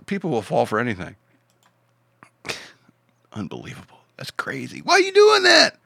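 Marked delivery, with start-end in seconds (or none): 3.3-4.8 unvoiced whispered disbelief. Real, not acted. 4.8-5.8 very high, loud exasperated cry; mock exasperation.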